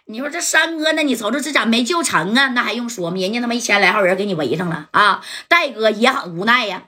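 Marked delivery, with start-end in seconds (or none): none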